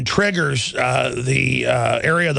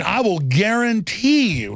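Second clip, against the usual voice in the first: ordinary talk, nothing marked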